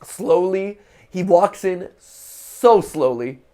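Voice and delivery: stretched words, loud and high; drawn-out slowly. Acted. Waiting on a man walking in, loudly drawn-out slow narration. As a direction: drag every word out to match the slow walk. Drawing out description of slow walk.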